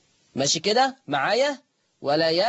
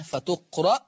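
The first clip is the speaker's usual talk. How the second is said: ordinary delivery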